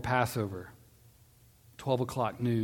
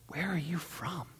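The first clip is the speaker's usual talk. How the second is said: hushed, higher-pitched, uneasy question; afraid; 6 semitones higher, 7 dB quieter than usual. A narrator's reading. Frightened Pilate asking Jesus his origin, hushed and afraid. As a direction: ask it softly, in fear. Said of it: "he was even more afraid".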